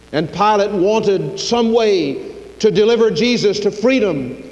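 high, projected pulpit delivery; ringing, emphatic sermon cadence; full-force preaching. Real, not acted.

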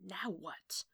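barely-there voiceless whisper; mouthed. A narrator's reading. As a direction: mouth it with only breath. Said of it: "and mouthed".

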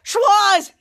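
loud, drawn-out cry of the name; retold call-out. Retold character calling out Schwoz.

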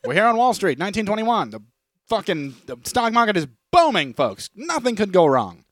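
loud Italian-American announcer; a hint of Italian-American hype; Italian accent. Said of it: "He's just immediately Italian".

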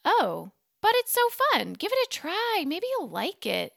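high, loud coaxing; encouraging. Acted. Boney urging Raps the mummy to dance, high and eager. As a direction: coax him eagerly. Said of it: "encouraged Boney".

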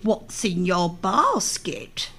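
low, slow, sugary wolf; sweet wolf voice. A narrator's reading. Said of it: "he asked sweetly".

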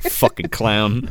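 low, slow grumble in character; Joe. Retold or imitated. Retelling Joe after hitting the clown, low slow contempt. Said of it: "he's like".

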